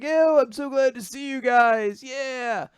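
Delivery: high, excited imitation of a hero; hammed-up high-pitched delight; super excited. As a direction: whoop it up with overjoyed greeting. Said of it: "Asta's super excited".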